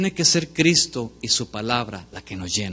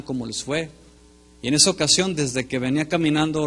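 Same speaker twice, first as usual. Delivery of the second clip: normal talking voice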